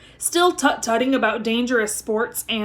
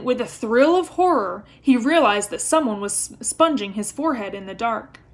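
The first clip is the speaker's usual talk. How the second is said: ordinary delivery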